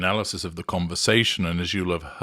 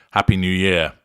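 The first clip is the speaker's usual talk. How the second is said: false jovial; 4 dB louder than usual. Retold or imitated. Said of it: "in a false jovial manner".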